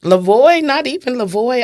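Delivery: retold screaming. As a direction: call out for the lost son in disbelief. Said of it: "I'm screaming".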